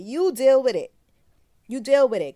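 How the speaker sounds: "You" hit loud and high, then "deal with it" thrown out hard; mock angry yell. To the father who won't take the baby, a loud mock yell.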